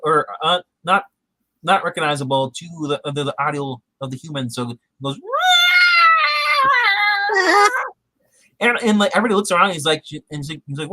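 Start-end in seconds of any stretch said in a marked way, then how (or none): 5.2-7.9 very high loud wailing squeal; alien distress call. Retold or imitated. Harry's alien distress call, a long high squeal. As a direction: let out a long shrill alien cry.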